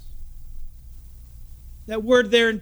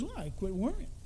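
calm patient; 12 dB quieter than usual. Retold or imitated. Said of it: "He goes".

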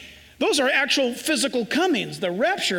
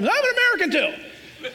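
much higher, proud declaration; proud shout; 9 semitones higher than usual. Retold or imitated. Proud American answering the speaker, high and excited. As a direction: jump up and declare it. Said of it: "I wanted to sh-, jump up".

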